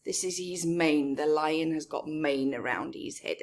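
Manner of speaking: plain reading voice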